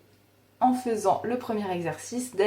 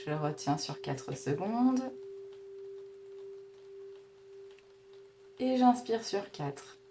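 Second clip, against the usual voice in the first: guided breathing; 11 semitones higher, 11 dB quieter than usual. Acted. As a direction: count the breaths gently, barely above a murmur. (voix douce de respiration guidée)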